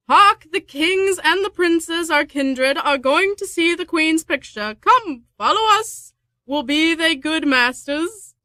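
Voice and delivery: high, bright stage-reading of a clown's lines. Acted. Player reads the Clown's lines aloud, high and hammy. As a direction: pitch it up and play the fool.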